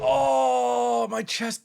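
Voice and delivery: high, drawn-out moan of soreness; sore groan. Real, not acted. Streamer feeling a sore chest, groaning. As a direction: groan it through the ache.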